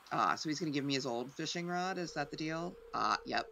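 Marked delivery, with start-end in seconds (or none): none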